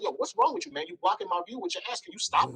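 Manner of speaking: high-pitched, fed-up complaint; retold exasperated confrontation. Man confronting someone blocking his view, retold exasperation. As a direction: act out finally telling him off.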